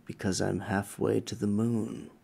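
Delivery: low, hushed eerie voice; creepy voice. Pretend. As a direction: spook the caller, low and soft. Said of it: "Why do you sound so creepy?".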